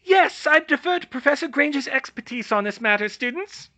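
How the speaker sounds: very high, piping little professor; piping Flitwick. Acted. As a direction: pipe up politely. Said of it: "Flitwick piped up".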